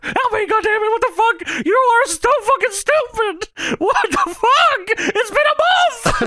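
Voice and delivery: slow, very high falsetto rant; hammed-up exasperated squealing, words dragged out; Paul Bearer. Wrestling manager berates useless Kane, slow high mock rant. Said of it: "Paul Bearer says".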